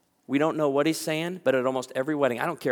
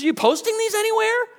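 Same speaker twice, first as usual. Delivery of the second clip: flustered woman; 11 semitones higher, 6 dB louder, faster than usual. Retold or imitated. Imitating anxious women.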